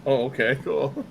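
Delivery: ordinary talk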